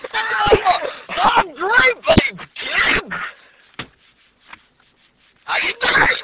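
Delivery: screamed, high, dragged-out ape greeting; Great Ape voice. Retold or imitated. Great Ape bursting in to greet the Hulk, screaming. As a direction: roar the greeting like a giant ape. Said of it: "and he's like".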